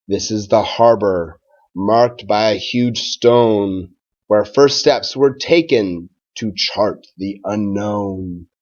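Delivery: put-on slow, even rhyming read-aloud for children; story read-aloud. A narrator's reading. Teacher reading a Thanksgiving rhyme to his class, gentle storytime read-aloud. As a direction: read it like a picture book at storytime.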